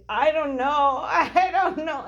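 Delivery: mock a helpless teary bride, half laughing; teary helpless whine. Bride to husband in the car, a mock teary whine. Retold teary wedding day meltdown.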